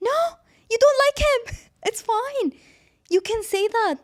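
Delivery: high loud no, laughing after; squealed no. Real, not acted. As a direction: squeal it in amused disbelief. Shrieked reaction laughing.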